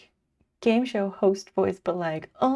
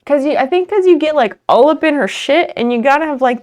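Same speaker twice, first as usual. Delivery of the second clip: excited; 5 semitones higher, 12 dB louder, faster than usual. Real, not acted. Excited fast explaining.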